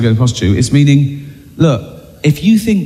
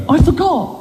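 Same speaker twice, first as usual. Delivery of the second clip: feeble excuse; 13 semitones higher than usual. Retold or imitated. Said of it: "with the judge going".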